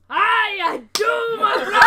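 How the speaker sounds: very loud, high dwarf shout; booming dwarf greeting. Acted. Dwarf recognising one of his own, roaring welcome. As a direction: bellow it in delight at a fellow dwarf.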